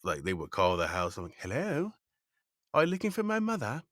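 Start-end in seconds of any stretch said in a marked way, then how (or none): 1.4-3.8 put-on very high, loud little British boy; British-accented child. Ten-year-old answering the house phone, high British accent. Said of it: "this is me at ten".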